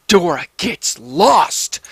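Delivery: slow, drawn-out tombstone words; slow ominous reading. Shown as a demonstration. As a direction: read the epitaph slowly, like a creepy inscription. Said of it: "with words on the tombstone that read".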